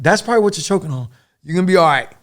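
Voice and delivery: urgent reassurance. Retold or imitated. Reassuring wounded medic.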